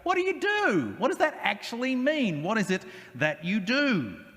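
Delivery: high, puzzled mock bewilderment; bewildered question. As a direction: ask it as if utterly baffled by the job title. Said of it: "I have to go".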